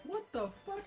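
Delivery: high-pitched retold shock; appalled yelp at an intruder, retold; shocked outburst. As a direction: exclaim it high, in mock disbelief. Reacting to intruder in car.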